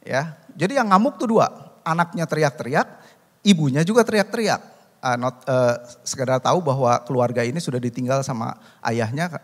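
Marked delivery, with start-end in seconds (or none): none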